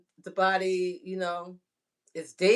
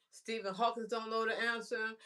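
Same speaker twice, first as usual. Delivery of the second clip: ordinary delivery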